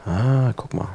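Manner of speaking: ordinary delivery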